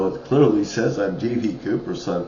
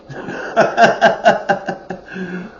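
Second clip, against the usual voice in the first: high, loud burst of laughter; big laugh; 11 semitones higher, 4 dB louder than usual. Real, not acted. Laughing over free advertisement in the park, big laugh. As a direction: laugh hard at your own story. Laughs at his own story.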